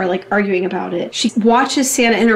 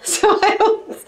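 laughing; 6 semitones higher than usual. Acted. [name] scoffs while laughing.

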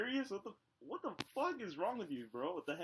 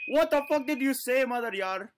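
loud, raised-pitch indignant challenge; mock outrage; 6 semitones higher, 9 dB louder than usual. Pretend robber answering a stranger's insult, loud mock outrage. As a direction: fire back at an insult, playing tough.

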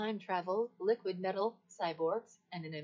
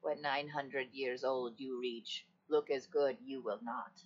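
low impression, well under the usual voice; movie-character impression; 7 semitones lower than usual. Shown as a demonstration. Assistant obliging a request for an impression, low character voice. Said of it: "Do an impression".

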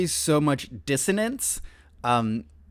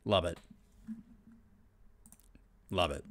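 soft afterthought; 9 semitones lower, 12 dB quieter than usual. Real, not acted. Quiet after shout.

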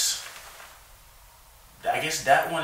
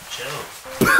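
ordinary talk

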